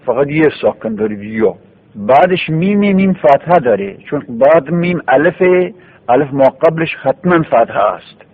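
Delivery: usual speaking voice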